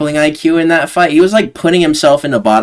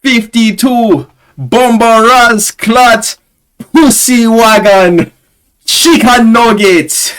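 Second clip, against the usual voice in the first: put-on higher, slow, louder Jamaican accent shout-out; Jamaican meme kid; 9 semitones higher, 5 dB louder, much slower than usual. Quoting the meme kid's list, loud high Jamaican accent. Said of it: "And he's like".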